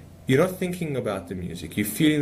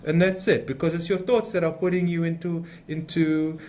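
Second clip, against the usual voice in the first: higher-pitched mock French accent; imitated guru with a dodgy French accent; 5 semitones higher than usual.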